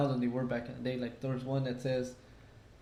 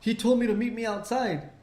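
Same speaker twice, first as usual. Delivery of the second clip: whiny coworker; 7 semitones higher, 5 dB louder than usual. Retold or imitated. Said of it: "and he goes".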